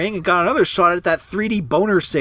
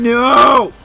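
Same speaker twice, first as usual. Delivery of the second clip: raging gamer; 2 semitones higher, 7 dB louder than usual. Real, not acted. Boss outcome.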